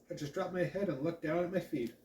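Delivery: plain reading voice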